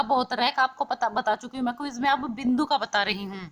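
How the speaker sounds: normal talking voice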